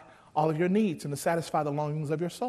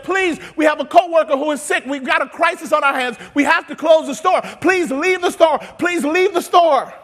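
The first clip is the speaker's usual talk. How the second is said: shouted, higher announcement over a PA; intercom announcement; 11 semitones higher, 13 dB louder than usual. Retold or imitated. Workers announcing over the intercom, loud and urgent. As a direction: call it out over the store intercom. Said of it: "they had to get on the intercom".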